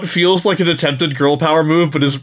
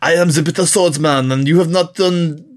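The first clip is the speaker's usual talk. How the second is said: hammy, badly faked French accent; terrible French accent; 2 semitones higher than usual. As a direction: put on a clumsy French accent. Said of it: "That's my terrible French accent".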